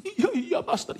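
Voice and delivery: very high-pitched mock whimper; acted mourner. Mourner agrees with the pastor, high mock whimper. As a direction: answer in a put-on tearful little voice.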